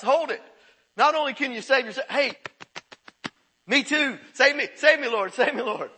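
loud, high, frantic cry for saving; desperate plea. Retold or imitated. Criminal on the cross begging Jesus, loud desperate plea. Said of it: "he realized".

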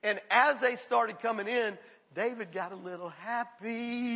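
ordinary talk, nothing marked

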